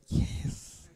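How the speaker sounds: ordinary delivery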